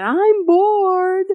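put-on high, whiny child's complaint; whiny kid. A child whining to mom that they're bored. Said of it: "in a whiny voice said".

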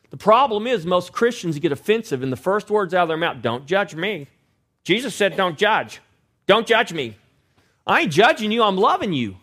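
full-force pulpit preaching; fiery preaching. Acted. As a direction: preach it hard to the congregation.

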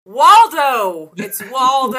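loud insistent Waldo; exasperated kid shout. Retold or imitated.

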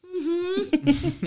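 put-on high, loud, laughing child hum; mock little-girl yes hum; imitating daughter's mm-hmm. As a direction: give a sheepish kid's mm-hmm. Daughter's answer voiced, laughing.

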